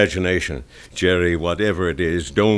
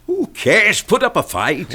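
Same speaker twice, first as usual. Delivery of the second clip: much higher, shouting him down; exasperated outburst; 10 semitones higher than usual. Acted. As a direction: brush off his excuse, then push. Paddy arguing with Jerry.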